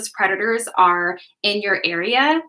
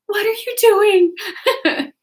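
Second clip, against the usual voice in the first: high, slow, laughing disbelief; drawn-out horrified question through laughter; mortified kid; 12 semitones higher, 4 dB louder, much slower than usual. Retold or imitated. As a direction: replay the shock of a kid who is embarrassed. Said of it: "I was just like".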